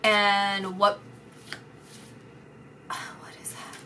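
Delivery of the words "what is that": hushed, breathy whisper to herself; a startled hushed whisper. Real, not acted. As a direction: whisper it as if seeing something. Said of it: "psychic sensing a vision".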